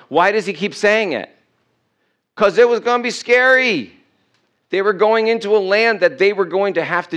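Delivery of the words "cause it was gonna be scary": raised high and loud; high-pitched, punchy outburst; emphatic exclamation. Real, not acted. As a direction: belt out the obvious answer. Emphatic answer to own question.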